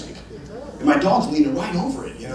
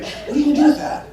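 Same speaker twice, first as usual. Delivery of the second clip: incredulous; 10 semitones higher, faster than usual. Retold or imitated. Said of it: "And I'm thinking".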